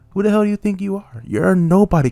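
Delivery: fast furious challenge to commenters. Acted. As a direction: spit the question out fast and high. Ranting at hateful commenters.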